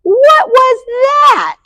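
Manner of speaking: slow, high-pitched shout of astonishment; startled exclamation. A narrator's reading. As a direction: shout it high, stretching each word out. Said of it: "exclaimed Skippy John Jones".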